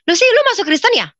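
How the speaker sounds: high, loud, rapid nosy question; blunt questioning. Retold or imitated. Singer friend asking about her conversion, blunt questioning.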